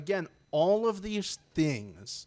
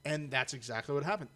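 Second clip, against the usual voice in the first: normal talking voice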